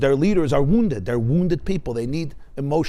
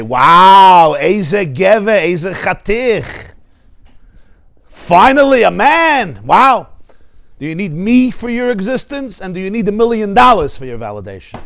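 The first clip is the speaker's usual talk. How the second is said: loud, high, delighted praise; admiring wife; 4 semitones higher, 9 dB louder, slower than usual. Acted. Wife praises husband who passed her test, loud and thrilled. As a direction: gush at him as a hero. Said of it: "that I say".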